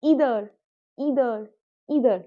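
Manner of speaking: "either" as EE-ther, British style; deliberate British "either" with a long "ee"; British pronunciation. Shown as a demonstration. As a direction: model "either" opening on "ee", falling each time. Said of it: "British".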